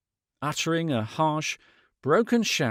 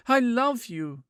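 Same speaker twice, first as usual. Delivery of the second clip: crying out; 9 semitones higher than usual. A narrator's reading. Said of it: "she cried".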